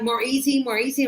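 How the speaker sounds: usual speaking voice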